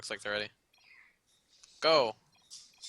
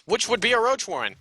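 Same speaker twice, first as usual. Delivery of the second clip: loud, high caster hype; excited; 9 semitones higher, 8 dB louder than usual. Real, not acted. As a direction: build it to a big reveal.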